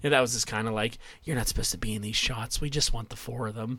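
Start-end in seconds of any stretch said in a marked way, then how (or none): 1.2-3.8 softer, lower shooing voice; hushed, brushing her off set; quietly shooing someone out of frame; mock dismissive producer.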